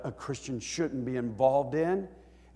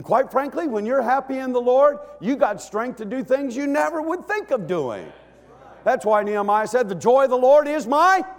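full-force preaching; 9 semitones higher, 6 dB louder than usual. Real, not acted. Preacher urging the congregation to praise, full force. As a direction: lift the church with a booming sermon.

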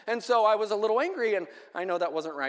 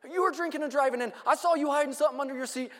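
higher-pitched, accusing officer imitation; belligerent cop; 6 semitones higher, faster than usual. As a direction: play the pushy cop grilling a teen. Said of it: "he is just railing on me and yelling".

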